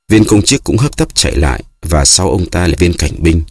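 ordinary delivery

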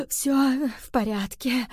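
slow, breathless reassurance; weak, laboured murmur, short of breath; gasping mutter. A narrator's reading. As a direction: gasp for air, then force the words out. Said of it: "пробормотала кошка".

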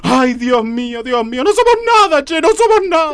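high-pitched mourning wail; lamenting. Acted. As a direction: wail piously over the dead man. Lamenting a supposed death.

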